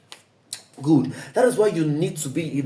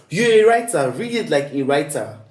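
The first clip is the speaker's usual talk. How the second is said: emphatic reminder; 4 dB louder than usual. Real, not acted. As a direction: insist it like a coach. Coach insisting to students.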